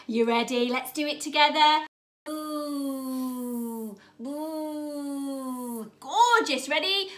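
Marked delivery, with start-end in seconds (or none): 2.2-5.9 long, low droning bee buzz. Pretend. Teacher showing children the sound bees make, low drawn-out buzz. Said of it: "What voice sound".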